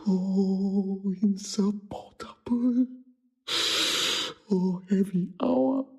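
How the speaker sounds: slow, broken, breath-heavy lament; stricken grief. Acted. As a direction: let the words sink under the loss. Othello realising his wife is dead.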